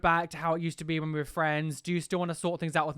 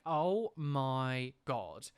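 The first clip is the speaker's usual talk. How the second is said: slow, drawn-out, quieter groan of disbelief; exasperated oh my god; 5 semitones lower, 5 dB quieter, much slower than usual. Retold or imitated. Retelling the endless new accounts, drawn-out exasperation. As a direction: drag it out like you're fed up. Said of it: "And I was just like".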